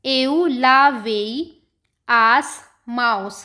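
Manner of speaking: each phrase very slow, said twice; slow example sentences. Shown as a demonstration. Teacher reading example sentences very slowly to students. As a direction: dictate the examples word by word. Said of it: "जैसे for example".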